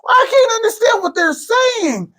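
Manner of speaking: high, slow, exasperated whine; mock confused listeners. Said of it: "People were like".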